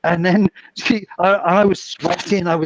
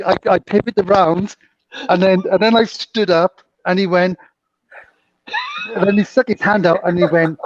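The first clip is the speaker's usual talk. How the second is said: usual speaking voice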